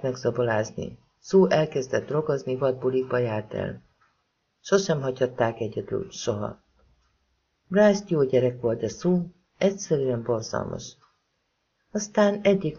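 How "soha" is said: plain reading voice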